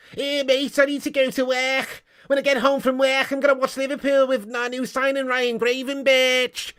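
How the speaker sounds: high-pitched caricature of a Scouser; Scouse accent. Acted. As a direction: chatter in Scouse, then drag out the name. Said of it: "it's the most Scousey".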